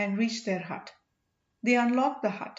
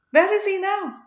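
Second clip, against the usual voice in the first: high, louder eager asking; eager question; 10 semitones higher, 8 dB louder than usual. A narrator's reading. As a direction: press him eagerly for the answer. Valli eager for prince news.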